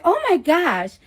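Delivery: put-on high, loud Staten Island girl gasp. OBGYN shocked by the sonogram, high Staten Island girl gasp. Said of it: "like, so loud like that".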